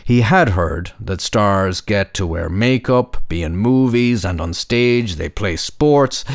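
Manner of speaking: ordinary delivery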